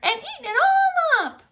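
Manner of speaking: very high loud whiny cub voice; Baby Bear discovering his empty bowl; squeaky Baby Bear. Acted. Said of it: "said Baby Bear".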